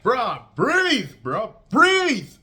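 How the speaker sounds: loud, high, urgent yell; urgent shouting. Retold or imitated. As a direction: yell it like coaching someone back to breath. Friend urging drowning man to breathe.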